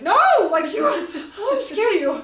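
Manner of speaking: high, loud, laughing protest; loud, laughing pretend fear; laughing mock fright. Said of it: "I was like".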